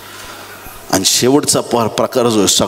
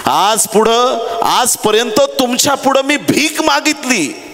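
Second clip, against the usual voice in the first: high-pitched impassioned complaint; pleading indignation, pitched well up; acted protest; 11 semitones higher, slower than usual. Beggar protesting to the crowd, acted.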